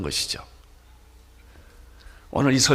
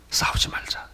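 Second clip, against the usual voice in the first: whispered plea; 7 dB quieter than usual. Acted. Abraham urging Lot not to fight, whispering.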